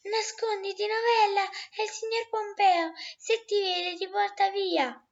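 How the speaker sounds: high, urgent warning cry; urgent shout. Acted. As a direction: yell at her to hide, now. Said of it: "Mica a sua volta gridò".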